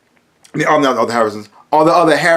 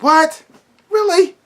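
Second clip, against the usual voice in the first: incredulous; 11 semitones higher, slower than usual. Real, not acted. Incredulous about the villain fight.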